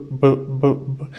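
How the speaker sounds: B said as a short bilabial puff, lips pressed then released; bilabial B sound. Shown as a demonstration. Teacher demonstrating the B sound to students, lips popping it out. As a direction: press both lips and pop out B with a puff of air. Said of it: "el sonido va a ser B".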